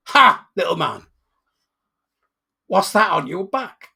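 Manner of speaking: slow, loud devil's taunt; devil's booming voice. Acted. Devil accosting the man with the clubfoot, booming and slow. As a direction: boom it slowly as the devil.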